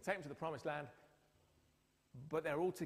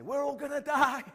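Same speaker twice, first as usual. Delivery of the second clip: loud, high, mock-alarmed announcement; mock news shout; 10 semitones higher, 12 dB louder than usual. Newsreaders on air telling the public, mock loud alarm. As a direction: blurt out the doom like a panicked newsreader.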